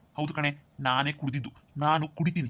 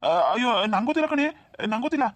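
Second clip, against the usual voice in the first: panicky denial; 6 semitones higher, 4 dB louder than usual. Acted. Son denies bringing the bottle.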